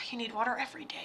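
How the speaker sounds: exasperated whisper after a sigh; whispered aside. Real, not acted. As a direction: whisper it under your breath, exasperated. Exasperated aside after quoting dad.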